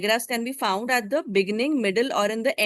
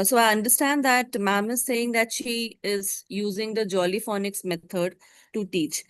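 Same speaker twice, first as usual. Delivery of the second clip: ordinary talk, nothing marked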